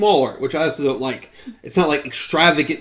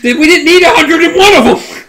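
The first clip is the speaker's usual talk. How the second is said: higher and louder, fed up; exasperated shout; 12 semitones higher, 11 dB louder than usual. Real, not acted. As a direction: shout the complaint. Heated mock argument.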